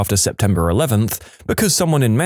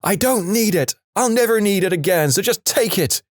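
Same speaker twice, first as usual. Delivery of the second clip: high-pitched yell without much volume; restrained, high retold scream; mock scream; 9 semitones higher than usual. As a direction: imitate a desperate shout, pitched way up. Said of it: "He's screaming".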